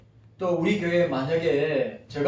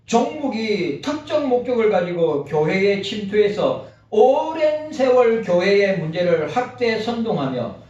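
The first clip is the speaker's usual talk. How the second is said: reading aloud; 4 semitones higher, 5 dB louder than usual. Acted. Continues reading the article.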